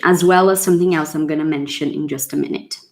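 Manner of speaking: ordinary talk, nothing marked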